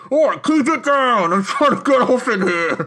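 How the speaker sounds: high, slow shout through a wall; from another room. Acted. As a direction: yell from the next room. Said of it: "you just hear someone from the other room".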